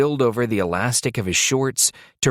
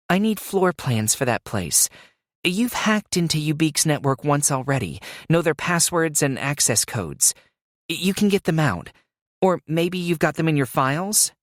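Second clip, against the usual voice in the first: put-on higher, earnest young woman; Alicia voice; 7 semitones higher than usual. A narrator's reading. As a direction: voice Alicia pressing her case. Alicia pleading for floor plans.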